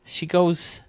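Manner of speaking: normal talking voice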